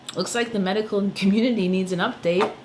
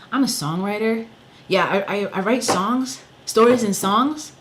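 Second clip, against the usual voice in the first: 1 defensive protest; 4 semitones higher, 6 dB louder than usual. Retold or imitated. Retold own protest to counselor.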